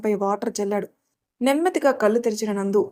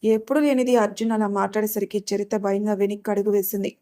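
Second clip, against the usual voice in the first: ordinary delivery, nothing marked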